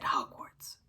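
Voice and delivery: breathy unvoiced whisper of the word. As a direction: breathe the name like a secret. Sirius recalls whispered word.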